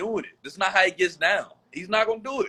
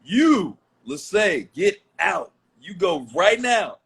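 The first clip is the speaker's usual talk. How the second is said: angry; 5 semitones higher, 4 dB louder than usual. Real, not acted. Ordering sister to leave.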